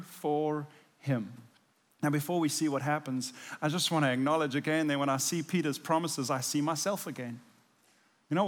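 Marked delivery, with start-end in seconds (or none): none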